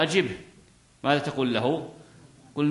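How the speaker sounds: ordinary talk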